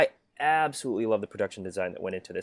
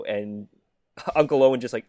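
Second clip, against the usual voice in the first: ordinary delivery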